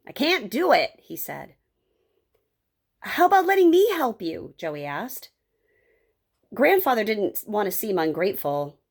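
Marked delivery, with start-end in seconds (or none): 0.0-1.0 stubborn refusal, voice rising; frustrated, stubborn old man; stubborn grandfather. Acted. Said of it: "Grandfather was stubborn". 3.0-4.5 put-on high, hopeful young Joey; bright-hearted boy's high-pitched offer; eager helpful boy. Boy offers grandfather his help.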